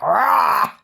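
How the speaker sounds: a short, loud mock monster "Rah!"; monster roar. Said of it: "They're like monsters".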